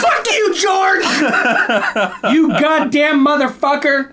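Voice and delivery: high, loud laughing yell; mock outrage. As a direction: shout at him in joking fury. Joking outrage at George Lucas.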